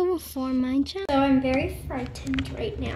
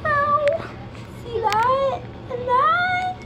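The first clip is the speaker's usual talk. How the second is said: high loud cries of pain; pained shriek; 4 dB louder, much slower than usual. Real, not acted. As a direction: cry out, showing the marks. Showing Lego pain results.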